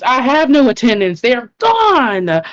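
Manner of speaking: high, loud exasperation; exasperated outburst. Acted. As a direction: snap back that they're all gone. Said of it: "She goes".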